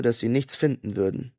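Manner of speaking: usual speaking voice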